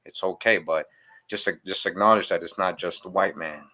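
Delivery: ordinary delivery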